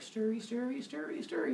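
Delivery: high, soft, climbing little chant; sing-song stirring. Acted. Zeus stirs in the potion, high sneaky sing-song. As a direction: stir along with the words, rising each time.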